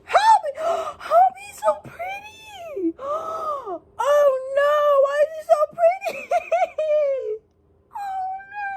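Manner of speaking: squealing half-laughing, half-crying; shrieking fangirl. Real, not acted. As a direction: scream helplessly at how pretty he is. Fan shrieks over the photo.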